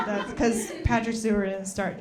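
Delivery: normal talking voice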